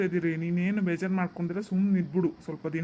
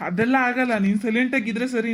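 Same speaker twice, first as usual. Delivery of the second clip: loud, raised voice ordering silence; angry shout; 3 semitones higher, 6 dB louder than usual. Acted. Son yelling at mother to be silent, angry. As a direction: cut her off hard.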